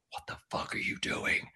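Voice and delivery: hushed, voiceless whisper of disbelief; retold stunned aside, fully whispered; aghast whisper. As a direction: whisper it in mock horror, under your breath. Private aghast reaction mid-recording.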